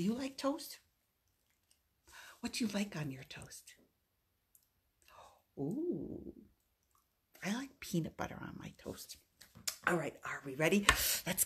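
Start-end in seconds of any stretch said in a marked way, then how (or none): 2.4-9.2 soft, secretive whisper. Pretend. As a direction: hush it like a playful secret. Hushed toaster game for child.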